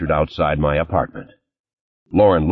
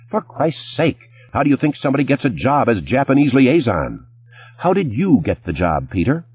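exasperated; 8 semitones higher than usual. Acted. Lauren keeps berating Peter.

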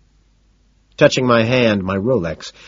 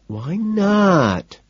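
higher, petulant pout; 10 semitones higher than usual. A narrator's reading. Evelyn demanding a reason from Patrick, petulant. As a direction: whine it like a sulking child. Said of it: "she asks, petulantly".

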